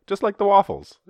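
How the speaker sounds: high, loud mock Flemish; Flemish accent. Belgians proudly likening vaccines to waffles, mock Flemish accent. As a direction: burst out in a comic Flemish accent. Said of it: "a Flemish accent".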